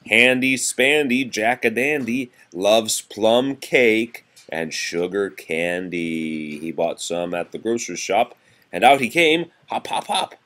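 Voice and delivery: lively sing-song nursery rhyme reading; reading to children. Acted. Parent reading seasons rhyme to a toddler, sing-song. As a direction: read it bouncy for a small child.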